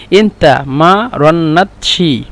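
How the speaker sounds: এনতা মা রন্নাতশি stretched very slowly, syllables apart; teacher drawing out এনতা মা রন্নাতশি piece by piece; slow split syllables. Shown as a demonstration. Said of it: "মা রন্নাতশি একসাথে উচ্চারণ করলে". (এনতা মা রন্নাতশি খুব ধীরে আলাদা আলাদা করে)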